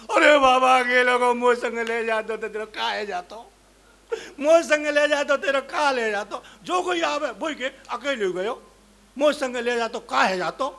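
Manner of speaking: loud sing-song lament; hammed-up keening; mock wailing. A second mourner wails loudly over the dead man. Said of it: "दूसरी आई".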